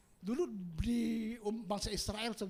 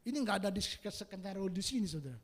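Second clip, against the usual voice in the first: ordinary talk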